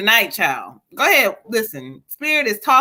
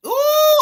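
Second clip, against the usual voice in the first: excited ooh. Real, not acted. Reader hoots at the spirit's message, high and excited.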